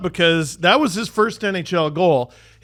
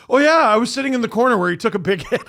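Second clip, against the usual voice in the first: fast, louder blurt, pitch up; awkward blurt; 8 semitones higher, 5 dB louder, much faster than usual. Retold or imitated. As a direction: rush it out like an awkward stranger. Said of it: "first thing that came out of my mouth".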